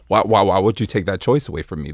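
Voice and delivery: ordinary delivery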